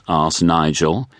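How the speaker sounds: usual speaking voice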